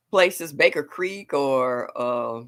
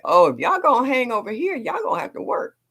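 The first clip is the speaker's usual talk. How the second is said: mock stern mom; 10 semitones higher, 4 dB louder than usual. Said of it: "And I was like".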